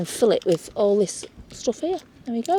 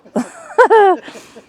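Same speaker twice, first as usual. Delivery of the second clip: loud, high burst of laughter; real laugh; 12 semitones higher, 7 dB louder than usual. Real, not acted. Laughing at her own Bristolian attempt, high real laugh. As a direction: laugh out loud, pitch leaping up.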